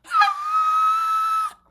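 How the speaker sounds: high, very loud sustained scream; screaming in someone's face, as a demo; demonstrated scream. Shown as a demonstration. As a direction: let out a long piercing "Ah!" at full voice. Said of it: "she begins screaming like this".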